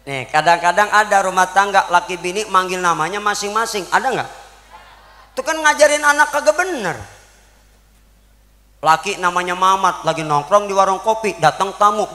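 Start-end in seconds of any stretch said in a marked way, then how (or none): none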